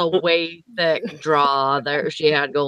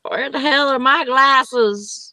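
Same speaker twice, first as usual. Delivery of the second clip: put-on grumpy old rocker; 6 semitones higher than usual. Imitating grumpy old person.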